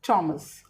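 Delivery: "थॉमस" said with a slight h after the t; "थॉमस" said as tomas with a breath; Thomas with light h. Shown as a demonstration. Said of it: "ह sound देते हैं". ("थॉमस" हल्की ह की आवाज़ के साथ)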